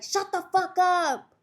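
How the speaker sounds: slow, high, loud imitated order; mock angry yell. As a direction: yell it like an annoyed cellmate. Acts annoyed cellmate yelling.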